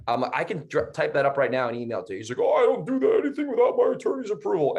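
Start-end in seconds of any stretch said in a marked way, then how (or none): 2.4-4.8 much higher, faster mock voice of a fussy buyer; squeaky mock of a buyer hiding behind his lawyer. Mocking the buyer refusing.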